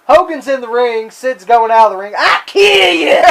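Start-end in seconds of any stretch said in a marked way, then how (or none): 2.2-3.2 slow, raised mock yell; hammed-up Sid threat; mock shout.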